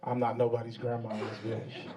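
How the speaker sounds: mock deep denial. Low mock indignant denial.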